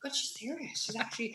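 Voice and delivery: quiet, under the breath; murmured to each other; hushed aside. Retold or imitated. Hushed aside about waitress.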